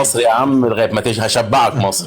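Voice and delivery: fast Egyptian Arabic street talk; quick Egyptian dialect for the listeners who asked for it. Shown as a demonstration. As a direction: rattle it off quickly in an Egyptian accent. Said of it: "les gens qui parlent égyptien".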